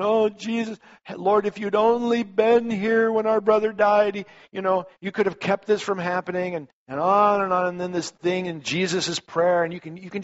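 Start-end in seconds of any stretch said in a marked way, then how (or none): 0.0-6.5 tearful sisters. Retold or imitated. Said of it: "ooh, crying".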